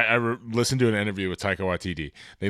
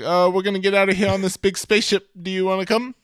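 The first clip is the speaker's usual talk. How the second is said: put-on New Zealand accent; 9 semitones higher, faster than usual. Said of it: "He's like".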